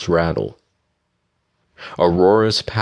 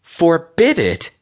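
high, slow, indignant echo; sharp; 12 semitones higher, 4 dB louder, slower than usual. A narrator's reading. Aurora challenging Octavius's order, sharp. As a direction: throw it back sharply, pitched way up. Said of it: "Aurora said sharply".

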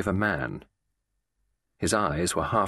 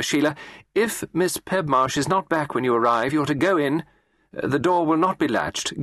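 higher, firm office supervisor voice; instructing boss; 7 semitones higher than usual. Acted. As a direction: give orders to a junior typist. Employer instructing her typist.

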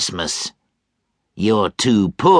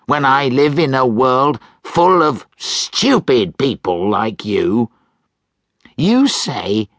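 put-on louder, higher angry old miser; ranting Scrooge; 6 semitones higher, 3 dB louder than usual. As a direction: scold the nephew in a rant.

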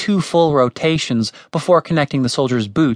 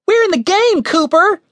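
very high, urgent squeak; 4 dB louder than usual. A narrator's reading. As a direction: pipe it up in alarm. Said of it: "Why was his voice so high?".

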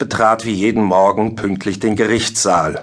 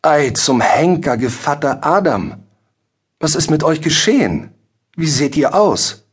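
higher, concerned dismay; bestürzt, mitfühlend; 6 semitones higher than usual. A narrator's reading. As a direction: exclaim with mildly shocked sympathy. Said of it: "zeigte er sich mitfühlend und bestürzt". (mitfühlend und bestürzt)

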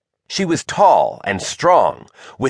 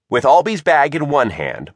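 usual speaking voice

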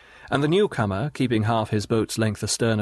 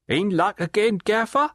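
high, sly leering call across the water; a higher, knowing river rat's voice; leering waterman; 11 semitones higher than usual. Acted. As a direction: Riderhood hailing Gaffer with a sly leer, pitched high. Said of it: "said a man with a squinting leer".

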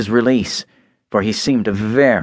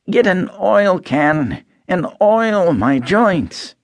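higher, slow rusted voice; stiff Tin Man, slow; 9 semitones higher, slower than usual. A narrator's reading. Tin Man begging Dorothy for oil, slow and higher. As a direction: ask slowly as if every joint is stuck.

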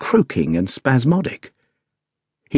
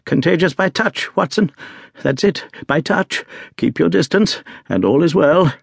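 much higher, urgent and driven; a sick Holmes pushing his friend away, feverish and higher; feverish energy; 6 semitones higher than usual. A narrator's reading. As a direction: warn him off in a fevered rush. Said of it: "He spoke now with a feverish energy".

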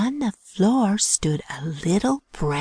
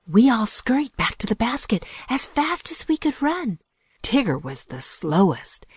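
ordinary delivery

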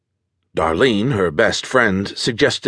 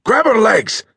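rough male order; 13 semitones higher, 3 dB louder than usual. Acted. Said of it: "he ordered his partner".

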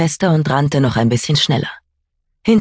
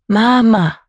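put-on higher, louder child whimper; crying child; 8 semitones higher, 4 dB louder than usual. Child reaching for mother.

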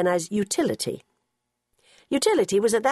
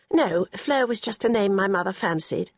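usual speaking voice

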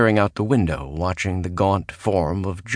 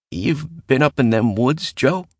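higher, wary voice rising on Joe; cautious question; 7 semitones higher than usual. A narrator's reading. Bill clearing his throat to ask Mallory, higher and wary. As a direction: probe carefully, not accusing.

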